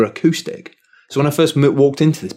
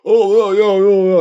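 mocking loud lads; 9 semitones higher, 4 dB louder than usual. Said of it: "chondling away about".

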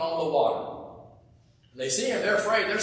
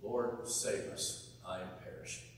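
soft prayer; 10 semitones lower, 13 dB quieter than usual. Shown as a demonstration. Showing the congregation a quiet personal plea to God. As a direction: murmur it as a humble prayer. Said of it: "another thing we could easily say to God".

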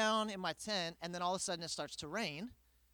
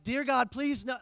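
high, loud desperate plea; pleading prayer; 6 semitones higher, 7 dB louder than usual. Retold or imitated. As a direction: beg God to make it stop. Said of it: "And I'm like".

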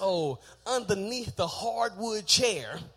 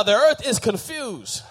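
louder congregational call-back; said loud on request; 9 dB louder, slower than usual. Shown as a demonstration. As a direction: say it out loud, on cue. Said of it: "Say it loud".

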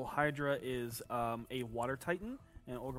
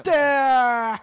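high, loud excited cry; excited shout; 12 semitones higher, 17 dB louder than usual. Real, not acted. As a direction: shout it out as you spot it. Excited discovery at the table.

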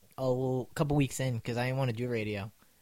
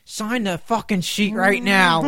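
slow, loud, higher stern command; mock furious order; 8 semitones higher, 10 dB louder, slower than usual. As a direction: spell out the order one word at a time. Professor ordering, retold.

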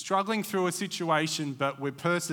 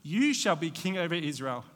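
scripture reading; 2 semitones higher than usual. Acted. Preacher reading Jonathan's words to the congregation, reverent. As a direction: give Jonathan's promise gently.

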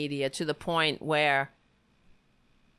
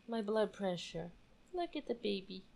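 soft and relieved; 12 dB quieter than usual. Real, not acted. Calmed by the dog.

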